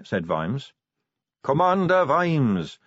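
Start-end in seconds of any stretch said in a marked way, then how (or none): 1.4-2.8 high, drawn-out welcome; effusive greeting. A narrator's reading. King hails Vimes like a long-lost son, effusively. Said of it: "greeting Vimes like a long-lost son".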